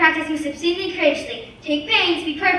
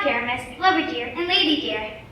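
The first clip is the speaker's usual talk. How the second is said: usual speaking voice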